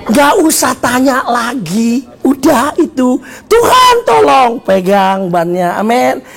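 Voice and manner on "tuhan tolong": high, drawn-out shout; mock yell for help. Drowning believer yelling for God to lift him, high shout. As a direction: stretch out a high cry to heaven. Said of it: "Ini teriak-teriak".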